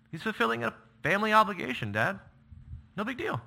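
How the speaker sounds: high, quick breezy reassurance to Dad; brushing it off, it's nothing; a son waving off his father's worry; casual son. Pretend. Voicing Jonathan to his father.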